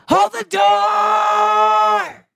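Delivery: long high drawn-out wail; wailing shout. Retold or imitated. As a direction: stretch it into a sobbing howl. Hodor death scene.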